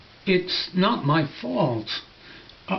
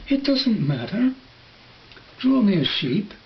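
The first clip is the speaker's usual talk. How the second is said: put-on higher childlike insistence; little prince; 5 semitones higher than usual. Little prince insisting to the pilot, higher childlike voice.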